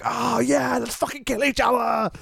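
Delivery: high, strained hyped shout; hyped-up fan. Acted. Host showing how Bruce's introductions fire him up, hyped shout. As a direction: shout it like a pumped-up fight fan. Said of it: "his introductions make me go like".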